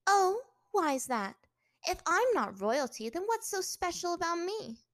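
high, loud, indignant questioning; challenging. A narrator's reading. Captive princess challenging her captor, indignant. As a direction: draw out the start, then press him.

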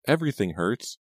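high, pained complaint; tearful pleading. A narrator's reading. Bound captive tells kidnappers she hurts, near tears. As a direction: whimper it out, close to tears. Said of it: "feeling about to cry".

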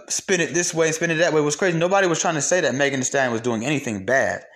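ordinary talk, nothing marked

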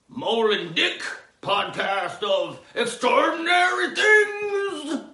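high, loud, slow show-title boom; announcer bellow. Acted. Announcing the podcast title, booming announcer.

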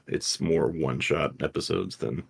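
usual speaking voice

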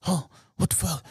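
a hint of frantic panic; mock panic. Trapped robber realizing he's going to jail, mock panic. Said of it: "you hear him panicking".

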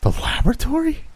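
very high, rising echo question; puzzled. A narrator's reading. Davidson answers his friend about the laboratory, puzzled. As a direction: query it high, quite baffled. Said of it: "he answered in a puzzled tone".

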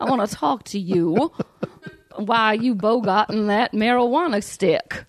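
hammed-up Southern sheriff. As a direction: question a suspect in a mock Southern twang. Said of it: "be like a Southern, like a Georgia sheriff".

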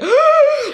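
loud, high-pitched gasp; mock shocked gasp. Podcaster acting out their reaction to the demon speech, shocked gasp. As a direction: gasp sharply in put-on shock. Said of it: "I'm like".

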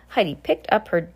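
plain reading voice

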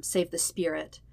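ordinary delivery

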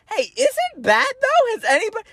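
high, loopy woozy voice; dazed brother. Retold or imitated. Bleeding brother asking his siblings about the soccer score, high and dazed. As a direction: play the dizzy kid, lightly silly.

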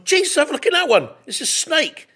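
shocked, high and loud yelp; suddenly startled, pointing it out; startled shout. Retold or imitated. To the floor manager, startled and loud.

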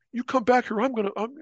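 high, blustering threat; lightly voiced menace; mock threat. Guy bowing up at narrator, mock threatening.

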